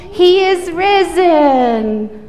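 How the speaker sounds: slow, loud proclamation; really loud. Shown as a demonstration. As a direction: call it out big and slow. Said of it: "Can you say that really loud?".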